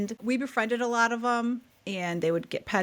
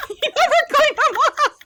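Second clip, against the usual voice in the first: much higher, louder, words through laughter; laughing hard; 8 dB louder than usual. Real, not acted. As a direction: crack up while saying it. Cracking up at the punchline.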